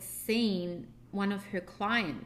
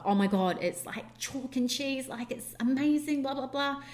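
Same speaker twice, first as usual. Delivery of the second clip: bright, raised-pitch rave about home birth; gushing excitement; 5 semitones higher, 3 dB louder than usual. Retold or imitated. Midwife raving about home birth, imitated gushing excitement. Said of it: "she was so excited to talk".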